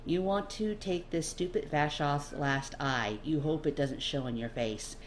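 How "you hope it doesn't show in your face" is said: ordinary delivery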